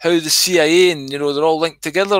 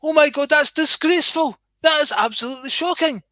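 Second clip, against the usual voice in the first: much higher, shrill-free prim mother voice, pearl-clutching; scandalised mum; 6 semitones higher than usual. Retold or imitated. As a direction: play the outraged churchgoing mum, pitch up high. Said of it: "She said".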